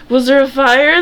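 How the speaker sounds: put-on a little girl gasping at news of a fire; surprised short girl. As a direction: pop up high and startled.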